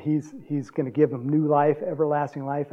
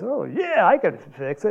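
Tony; 5 semitones higher, 3 dB louder than usual. Retold or imitated. Voices handy friend Tony agreeing.

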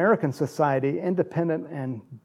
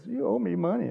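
jaded cop; 6 semitones higher, 3 dB quieter than usual. Retold or imitated. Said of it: "This guy pulls me over and goes".

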